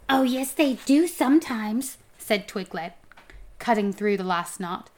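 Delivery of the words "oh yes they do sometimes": higher, louder teasing voice; taunting homunculus. A narrator's reading. Homunculus teasing the scared dwarf, higher-voiced taunt. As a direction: needle him in a raised voice.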